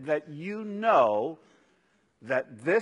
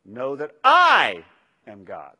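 "I" hit hard and loud, "am God" dropping away; stress on I; 3 semitones lower, 12 dB louder than usual. Shown as a demonstration. Preacher showing the congregation the verse with stress on "I". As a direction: lean all your weight on "I". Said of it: "is to say that in different ways".

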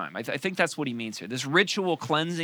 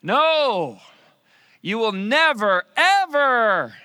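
higher, louder, slow NEVER; emphatic refusal; 8 semitones higher, 8 dB louder, much slower than usual. Retold or imitated. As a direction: insist with all your force. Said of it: "it's emphatic".

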